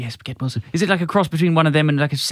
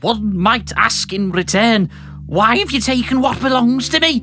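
loud, higher villain's bark; 6 semitones higher, 5 dB louder, slower than usual. Shown as a demonstration. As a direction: bark out the threat, stretching the words. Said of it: "you got to bark this".

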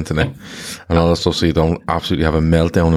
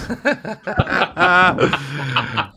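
long, high-pitched laugh; real high laughter at a blunt reply; 10 semitones higher than usual. Real, not acted.

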